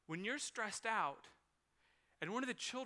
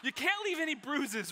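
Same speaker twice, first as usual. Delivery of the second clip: ordinary talk, nothing marked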